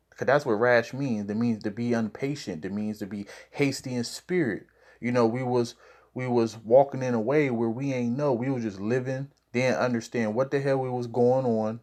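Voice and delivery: normal talking voice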